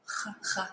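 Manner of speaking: Ch, ch voiceless throat friction, no voice; breathy ch. Shown as a demonstration. Teacher demonstrates the voiceless throat sound in grenier. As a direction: rasp a voiceless ch. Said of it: "get that ch in there".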